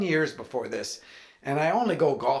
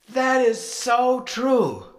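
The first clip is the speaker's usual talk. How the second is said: high, slow, loud drawn-out awe; awed Ronnie; 10 semitones higher, 6 dB louder, much slower than usual. Retold or imitated. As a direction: gush it like a revelation. Ronnie voiced, awed agreement.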